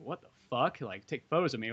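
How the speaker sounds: soft, taken-aback aside in imitation; retold wary disbelief. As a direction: drop the volume, suspicious of the offer. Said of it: "And he's like".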